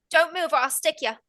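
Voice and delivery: put-on high-pitched little girl with an Irish accent. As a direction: threaten in a put-on Irish lilt. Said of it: "She has an Irish accent".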